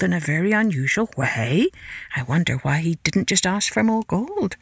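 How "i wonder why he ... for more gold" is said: ordinary delivery, nothing marked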